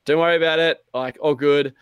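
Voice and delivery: high, loud forced breezy reassurance; mock cheerful. Gamer after dying, loud mock-breezy shrug. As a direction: brush it off loudly, faking calm. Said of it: "I was like".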